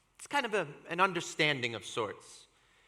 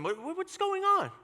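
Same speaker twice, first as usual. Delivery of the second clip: high-pitched, excited stammered question; breathlessly eager, much higher pitch. Retold or imitated. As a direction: ask eagerly, voice shooting way up. Friends excitedly question shouting Ira.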